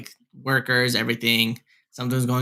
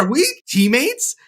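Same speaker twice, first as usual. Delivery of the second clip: high, slow incredulous question; retold disbelief; 12 semitones higher, 4 dB louder, slower than usual. Asking Megan about the leaked surprise, stunned disbelief. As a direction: draw the question out high, as if just realizing. Retelling realization they joined the team.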